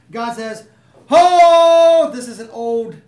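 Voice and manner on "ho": sudden loud shout of Ho; yelled call. Retold or imitated. Preacher voicing God's call to the crowd, loud shout. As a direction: bark it out big to grab attention. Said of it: "so it's like it's yelled".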